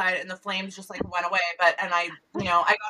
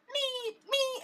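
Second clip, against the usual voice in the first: fire alarm beeping; 10 dB quieter than usual. Retold or imitated. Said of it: "the fire alarm goes off. It's like".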